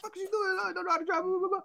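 retold outraged rant, tossed off; mock yell. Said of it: "She yelled".

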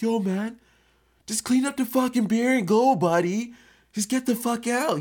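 high, loud furious shouting at a guest; imitating the house owner yelling at him to get out; screaming mad homeowner; angry yell. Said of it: "He was pissed".

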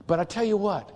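ordinary talk, nothing marked

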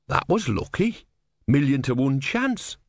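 put-on simple, wide-eyed young monk; naive young novice. Brother speaks.

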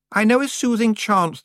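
put-on high, loud, complaining girl's voice; whiny young witch. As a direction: whine like a spoiled out-of-depth girl. Anagramma voice.

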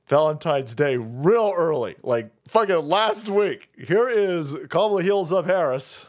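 ordinary delivery

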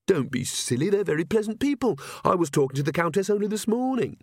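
lightly voiced queen, reassured and dismissive. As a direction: wave the worry away, voice climbing.

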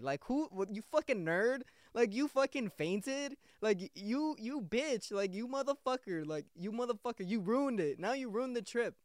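high, scornful jeer at himself; mocking self-scolding. Imagining his friend scolding him for fainting on the trip, a high scornful jeer. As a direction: sneer at yourself like a disappointed buddy.

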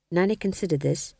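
usual speaking voice

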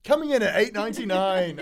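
loud, high ad pitch; really excited voice. Acted. Demonstrating boring small print read out for an ad, really excited. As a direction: shout the price like an over-keen salesman. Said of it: "in a really excited voice".

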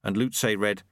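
ordinary talk

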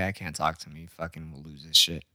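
mom's voice. Retold or imitated. Mom quoted, low and soft.

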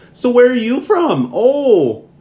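high, loud mock Minnesota accent; imitating a Minnesota local asking where you're from. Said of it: "just in that Minnesota accent".

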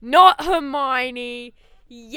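very loud, stretched-out parody line; hammed-up movie quote. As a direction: draw it out with mock drama. Repeating the famous movie quote.

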